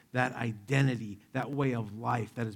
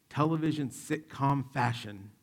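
normal talking voice

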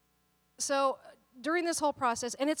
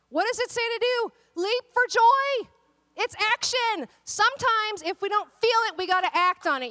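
loud, high, urgent preaching; rally the congregation to act; fiery preaching; 9 semitones higher, 8 dB louder than usual. Acted. Preaching at full force.

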